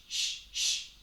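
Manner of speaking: short breathy shh pulses; shh sound. Shown as a demonstration. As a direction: push out little shh bursts from the abs. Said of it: "give me shh".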